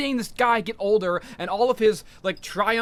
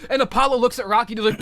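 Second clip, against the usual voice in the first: ordinary delivery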